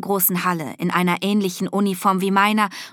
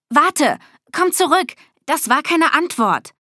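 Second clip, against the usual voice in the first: high, raised-voice protest; urgent calling out; 7 semitones higher, 4 dB louder than usual. A narrator's reading. Girl calling the cat back, urgent and indignant. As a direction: call after someone getting away.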